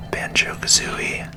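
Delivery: normal talking voice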